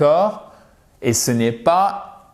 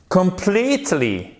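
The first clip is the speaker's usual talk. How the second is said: completely. raised and slowed on the middle; stress demo; 6 semitones higher than usual. Shown as a demonstration. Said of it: "accent tonique ici". (completely. avec l'accent tonique au milieu)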